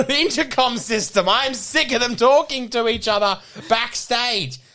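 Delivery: loud exasperated complaint to the crew; annoyed loud complaint. Pretend. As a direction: demand they cut the intercom, fed up. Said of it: "he's really angry at me".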